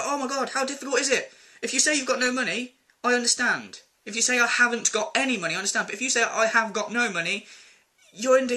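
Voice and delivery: exasperated. Real, not acted. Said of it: "exasperated rant about grammar".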